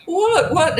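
high-pitched mock bewilderment; feigned innocence. Housemates trying not to laugh at police, feigned innocence. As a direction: play dumb for the officers. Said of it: "we're trying not to laugh".

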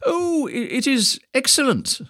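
put-on high, slow, stammering old man; flustered old man. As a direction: stumble over a nervous lie. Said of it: "replied the old wise man".

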